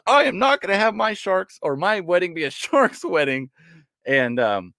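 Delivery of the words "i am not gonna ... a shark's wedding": stern wife. Retold or imitated. Wife refusing shark theme.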